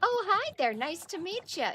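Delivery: high, bright mock greeting; hammed-up copy of a friend's hello; imitating Solo. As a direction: parrot a teammate's cheerful hello. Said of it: "I read it in Solo's voice".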